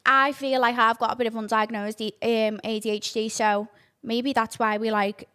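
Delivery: ordinary talk, nothing marked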